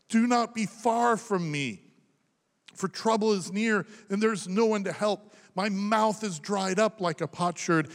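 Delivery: high, pressing plea, slowing on the last line; urgent psalm reading. Acted. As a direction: plead with God through the scripture. Reads psalm of anguish aloud.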